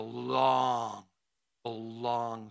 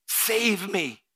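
much higher and louder plea; pleading prayer; 7 semitones higher, 8 dB louder than usual. Acted. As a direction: cry out to God. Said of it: "Just say, "Lord, I surrender".

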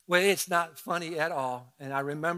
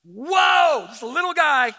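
put-on high, loud startled cry of a scared boy; scared little boy shouting whoa; startled yelp; 10 semitones higher, 12 dB louder, slower than usual. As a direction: yelp it out like a kid seeing wasps swarm. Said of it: "and I'm like".

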